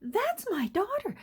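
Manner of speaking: put-on high, wavering voice of a sweet old mother; elderly mother's voice. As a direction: sound like a delighted elderly mom who thinks she sees a photo. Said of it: "I can hear her saying".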